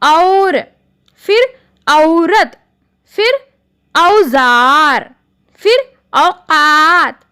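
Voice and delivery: औ words drawn out slowly and clearly; careful example words. Shown as a demonstration. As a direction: stretch the औ sound in each example. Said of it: "तो". (औ वाले शब्द धीरे और साफ़)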